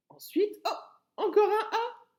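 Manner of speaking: high, loud, with a laugh; gleeful discovery; delighted surprise. Real, not acted. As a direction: laugh as you spot it. Discovering another letter A.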